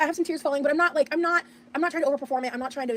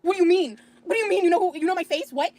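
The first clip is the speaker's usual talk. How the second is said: retold astonishment; 5 semitones higher, 3 dB louder than usual. Retold shock at being recognized.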